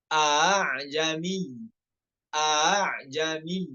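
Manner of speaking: slow "A'jami" with the second hamzah eased; tashil of hamzah. Shown as a demonstration. Teacher demonstrating tashil slowly. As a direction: glide through the hamzah in "A'jami". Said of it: "Itu cara yang benar".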